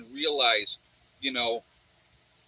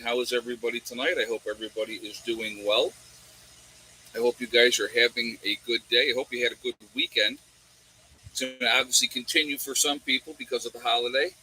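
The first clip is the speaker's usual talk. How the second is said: ordinary talk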